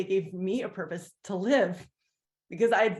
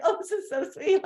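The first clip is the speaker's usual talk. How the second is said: much higher, gushing with delight; 12 semitones higher, 4 dB louder than usual. Retold or imitated. Visiting aunt delighting in bathing under the village pump, thrilled high gush. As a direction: squeal it like a thrilled newcomer. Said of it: "I was like".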